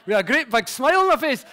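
can't hold back the laughter at the memory; laughing. Real, not acted. Telling the host about his dance, laughing hard.

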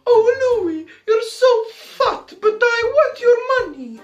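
very high hammed-up falsetto woman; Marie Antoinette. The queen begging not to be beheaded, high mock falsetto. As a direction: plead in an exaggerated squeaky female voice. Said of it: "not set out to be a woman".

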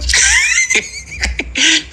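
high-pitched burst of laughter. Real, not acted. Natawa sa pinanood na clip. (mataas na halakhak)

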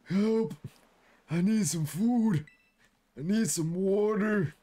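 lightly voiced feeble creature, slow; mild mock pitiful plea; weak creature voice. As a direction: plead weakly like a starving critter. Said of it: "He's like".

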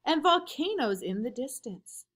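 ordinary delivery, nothing marked

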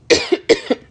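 sharp choking cough; sudden real coughing fit. Real, not acted. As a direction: cough to clear a throat catch. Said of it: "I'm getting choked up".

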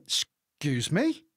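slow, offended "excuse me"; indignant. Retold or imitated. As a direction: stretch it out, daring him to repeat it. Confronting the swearing driver.

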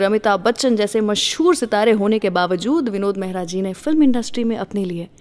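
normal talking voice

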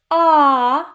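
"А" held long, loud and high; isolated vowel. Shown as a demonstration. Teacher shows the vowel, long loud high А. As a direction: sing out a long open "А". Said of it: "Це голосний".

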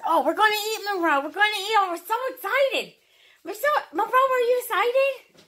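high, excited pretend dog talk. Owner speaks for hungry dog about to eat, high and excited. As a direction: voice an eager dog, pitched well up.

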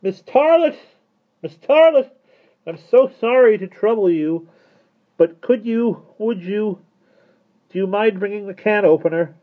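very high, loud calling; shouted. A narrator's reading. Mister Turvey calling Miss Tarlett downstairs, shouting. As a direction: call out to someone beyond the door. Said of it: "shouted through the crack".

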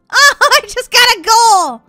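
high, loud, drawn-out cheer; excited cheer. Real, not acted. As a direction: cheer it with a laugh. Scores a goal in game.

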